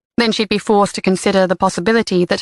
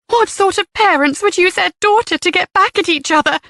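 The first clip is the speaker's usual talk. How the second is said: trembling voice; 11 semitones higher than usual. A narrator's reading. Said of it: "her voice trembling".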